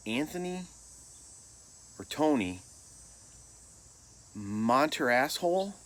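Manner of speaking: halting, questioning roll call with long pauses; unsure coach calling names, rising at the end; coach misreading roster. Retold or imitated. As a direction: puzzle out an illegible name aloud. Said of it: "must not have been able to read his own handwriting".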